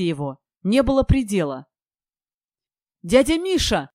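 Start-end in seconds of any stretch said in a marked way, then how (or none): 3.0-3.9 higher, excited call-out; joyful call. A narrator's reading. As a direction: call out to him in delight. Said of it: "закричал Кирилл".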